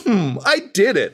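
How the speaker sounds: proud of a half-assed job; mock smug. Mocking the enemy's lazy barricade.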